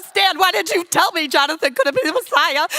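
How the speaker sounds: loud, high comic wailing; hysterical crying, acted. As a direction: wail at your husband in hammed-up hysterics. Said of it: "I'm hysterical".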